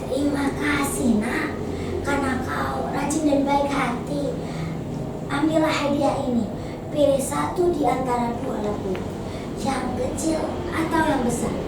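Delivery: soft, unhurried granny voice; gentle old woman. Acted. Grandmother offering the girl a gift, gentle. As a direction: thank the child very softly and tenderly.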